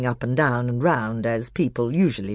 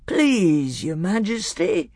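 higher, pleading drowsy bear; thick, sleepy voice; 6 semitones higher, slower than usual. A narrator's reading. Bear begging Peter to be marshal, sleepily. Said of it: "sleepy voice said".

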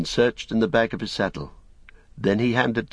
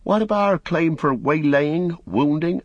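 Pippin; 10 semitones higher, 3 dB louder than usual. A narrator's reading. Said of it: "said Pippin".

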